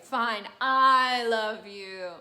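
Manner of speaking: very slow, loud, exasperated; loud slow confession. Acted. Adina finally confessing to Nemorino, loud and slow. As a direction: blurt the confession out, drawn out. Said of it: "she's like".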